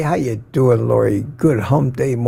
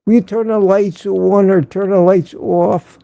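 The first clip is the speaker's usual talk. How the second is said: put-on higher-pitched Coffee Talk accent; 6 semitones higher than usual. Host imitating a comedy sketch about coffee, in a coffee talk accent. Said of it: "when he did that coffee talk".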